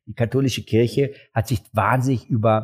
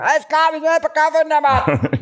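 mock reply; 4 dB louder than usual. Mock answer in high voice.

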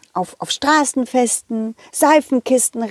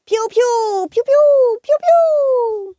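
put-on high, bright kid voices shooting water pistols; squeaky children going piu piu; gleeful little kids at play; excited kids; 14 semitones higher, much slower than usual.

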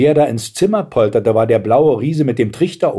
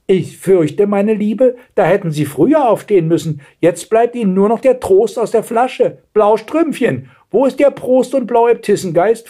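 high-pitched mocking old woman; scornful grandmother; 11 semitones higher, 3 dB louder than usual. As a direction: advise with haughty sarcasm. Grandmother consoles, then demands bottle. (spöttische Großmutter, hohe Stimme)

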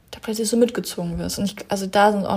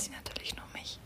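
whispering; 20 dB quieter than usual. Real, not acted. Joking aside to listeners.

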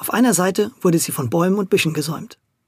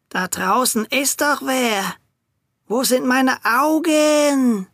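put-on slow, higher croaky old man; croaking old man; 7 semitones higher, much slower than usual. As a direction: creak it out like a frail old man. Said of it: "krächzte drinnen der alte Hinkelfuß".

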